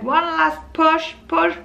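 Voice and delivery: high, loud and slow, imitating the midwife's urging. The midwife urging the mother to give one last push, high and loud. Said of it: "She said".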